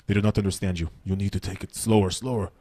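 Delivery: Russian promoter. Retold or imitated. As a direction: lean in like a stern Moscow promoter. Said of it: "the guy running the gigs goes to me".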